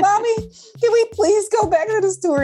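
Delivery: put-on high, pleading little-kid voice; child pleading to go back to the store, high and begging. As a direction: beg Mommy like a small child. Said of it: "I'm like".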